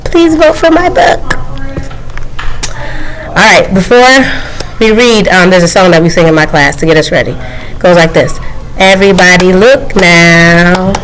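ordinary delivery, nothing marked

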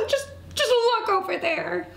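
high, choked-up mouse voice; holding back tears. Acted. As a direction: stammer it while fighting tears. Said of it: "She held back her tears".

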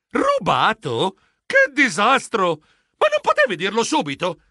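horrified. A narrator's reading. Geronimo learns the precious egg is gone, horrified. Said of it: "Io sbiancai".

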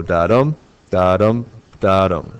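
"dadum" as an even beat, landing on dum; iambic beat. Shown as a demonstration. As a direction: chant each "dadum" with a weak da and strong dum. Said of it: "That would be three dadums".

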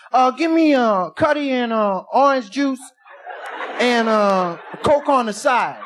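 big spender hollering at the waitress. Acted. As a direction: shout the order over the crowd. Acts out drunk ordering in bar.

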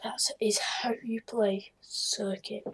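slow hushed whisper; a soft smug whisper. Real, not acted. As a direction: breathe it out smugly, slow. Gloating after winning the race.

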